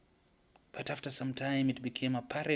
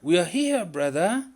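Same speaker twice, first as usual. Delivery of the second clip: loud, higher answering shout; shouting back; 7 semitones higher, 10 dB louder than usual. A narrator's reading. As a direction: shout back across the water. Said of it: "Leopard shouted back".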